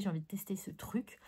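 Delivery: breathless confidential whisper; whispered aside. Real, not acted. To viewers, sharing eager plans for new pencils, whispered. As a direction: whisper it like a secret. Excited secret plan aside.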